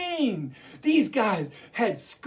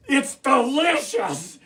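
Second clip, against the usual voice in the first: delighted exclamation; 5 semitones higher, 4 dB louder, slower than usual. Acted.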